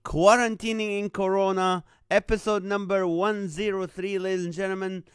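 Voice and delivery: loud, upbeat live show host opening; big announcer energy welcoming each viewer by name; show host welcome. Acted. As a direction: greet the audience like you're opening a TV show.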